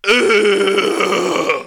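winded groan after the fall; a pained grunt from the ground. Retold or imitated.

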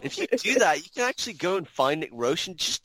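normal talking voice

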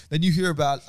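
ordinary delivery

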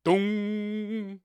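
loud, high "Dong!" called out; announcer's mock clank. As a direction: shout the goalpost hit. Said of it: "and you hear".

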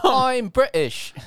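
drawn-out, high mock British accent. Shown as a demonstration. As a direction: put on a silly British voice. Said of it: "I can do an accent".

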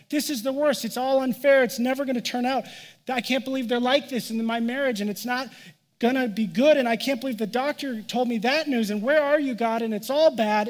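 a worried believer ranting to God about marriage and bad news; mock panicked rant. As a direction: play the frantic catastrophizing believer. Voicing a congregant's panic.

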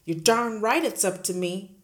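loud bellow. Acted. Headmistress bellowing at the teacher, loud. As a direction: bellow it triumphantly. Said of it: "Miss Trunchbull bellowed".

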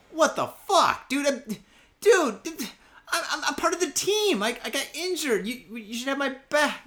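high-pitched, stammering protest; wounded indignation. Retold or imitated. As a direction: plead in outrage as the let-down employee. Protests that boss won't back him.

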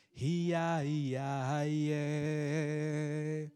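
fervent sermon chant; drawn-out preaching. Acted. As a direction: stretch each word like a rising chant. Preaching chant, rivers of water.

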